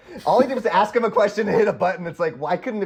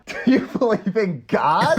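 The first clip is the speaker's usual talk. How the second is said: higher, slowed-down mock quote; film character's question; 4 semitones higher, slower than usual. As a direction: draw out the question, hamming it up. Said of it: "when he's like".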